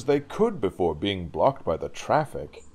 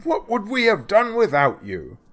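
high, loud praise; grateful cry; 11 semitones higher, 6 dB louder than usual. Acted. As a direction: exclaim it gratefully. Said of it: "cried the fireman".

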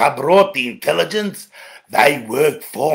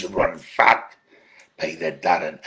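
normal talking voice

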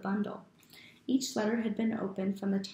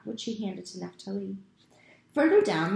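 plain reading voice

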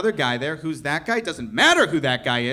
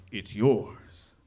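Beast voice; 8 semitones lower than usual. Retold or imitated. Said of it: "he's like".

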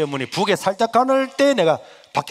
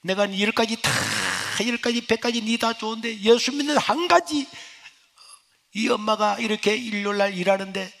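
scolding mother; 8 semitones higher than usual. Retold or imitated. Mother berating her churchgoing son, rising scolding. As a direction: pour out a mother's grievances, building.

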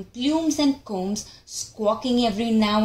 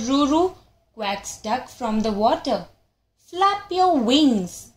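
ordinary delivery

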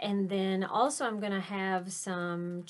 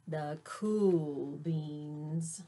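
very slow, lower, laid-back drawl; cool drawl; 4 semitones lower, much slower than usual. A narrator's reading. Teacher savoring the name of the cool beans, slow cool drawl. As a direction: stretch it out, too cool to care.

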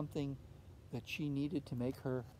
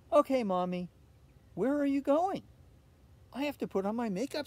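put-on cheeky girl; 8 semitones higher, 7 dB louder than usual. A narrator's reading. Olivia answers mother.

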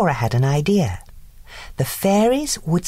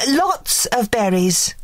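high, slow fairy voice; sing-song fairy chirp; fairy sending the girl out, sweetly; 8 semitones higher, slower than usual. A narrator's reading. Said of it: "added Flora".

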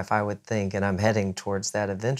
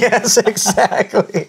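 laughing through the words; 12 semitones higher, 10 dB louder, slower than usual. Real, not acted. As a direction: laugh as you agree. Laughing agreement.

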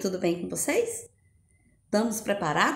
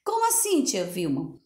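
pupil asking. Acted. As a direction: play a curious little kid. Teacher voices a confused pupil.

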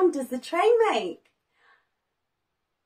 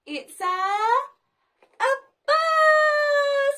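excited reveal; 12 semitones higher, 5 dB louder, much slower than usual. Acted.

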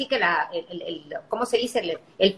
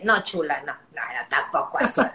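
much lower and softer, laughing no; teasing family reply; 9 semitones lower, 4 dB quieter than usual. Retold or imitated. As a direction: deflate her, chuckling. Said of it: "me dice".

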